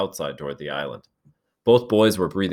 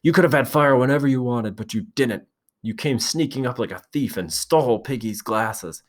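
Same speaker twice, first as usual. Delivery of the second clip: higher, heated, accusing voice; angry accusation; 6 semitones higher than usual. A narrator's reading. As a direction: keep hammering the accusation home. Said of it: "Ralph's temper blazed out".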